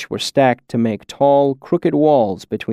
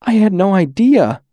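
high tearful cry; near tears; 9 semitones higher, 4 dB louder than usual. A narrator's reading. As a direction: voice breaking, close to crying. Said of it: "close to tears".

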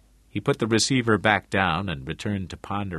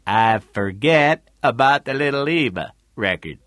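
louder character voice echoing a line; Tootie's repeating; 3 semitones higher, 6 dB louder than usual. A narrator's reading. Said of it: "Tootie repeated".

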